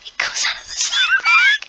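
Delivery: extremely high-pitched delighted shriek; amazed squeal. Real, not acted. Amazed by the prize's effect.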